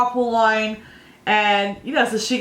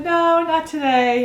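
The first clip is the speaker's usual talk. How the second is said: put-on higher, slow little-girl reply; daughter's small voice; 7 semitones higher, slower than usual. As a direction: lightly voice a young girl saying no.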